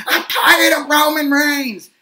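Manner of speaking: high, slow, loud whiny mock yell; mock angry rant. Podcaster mocks an angry YouTuber's loud whiny yell. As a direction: caricature an angry fan's outburst. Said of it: "putting himself in going".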